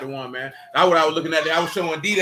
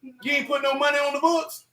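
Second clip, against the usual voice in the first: higher, pressing questions, half laughing; mock accusing questions; 8 semitones higher than usual. Real, not acted. Teasing Michael about not supporting him, mock outraged. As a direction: grill her in playful outrage.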